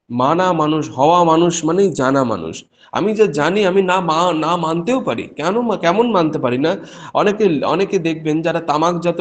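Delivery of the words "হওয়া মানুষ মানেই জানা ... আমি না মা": ordinary delivery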